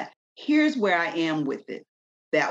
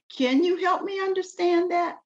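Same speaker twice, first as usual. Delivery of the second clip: sweet, much higher questioning voice; polite gentle tone; 10 semitones higher than usual. Shown as a demonstration. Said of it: "it's how you say it and the tone you say it in".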